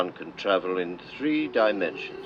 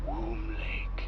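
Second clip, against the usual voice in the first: breathy hushed whisper of the place name; 2 semitones higher, 4 dB quieter than usual. Acted. Tour guide whispering the next stop to visitors. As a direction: whisper it like a secret reveal.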